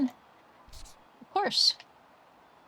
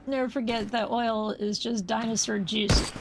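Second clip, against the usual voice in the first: ordinary delivery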